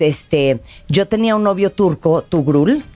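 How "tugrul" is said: normal talking voice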